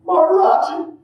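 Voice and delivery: very high, loud mock rapture; ecstatic reply. Blissed-out devotee answers the lady, high mock ecstasy. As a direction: cry the name out in acted bliss.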